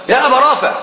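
high called name in the dark; calling out loud. Retold or imitated. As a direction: call his name loudly, retold. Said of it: "بأعلى صوتي".

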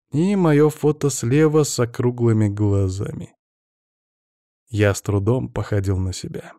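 usual speaking voice

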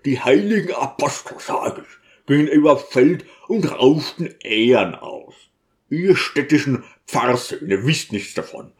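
country deacon's character voice, stubbornly arguing; defiant pleading in the deacon's voice. Acted. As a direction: argue back bluntly, then refuse to yield.

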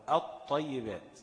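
الطيبات drawn out very slowly as aṭṭayyibāt, l silent; slow demo of assimilation. Shown as a demonstration. Teacher demonstrates to students, الطيبات slow, no l. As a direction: stretch الطيبات slowly, doubled ṭ and no l. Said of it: "بقت همزة بعدها طاء على طول".

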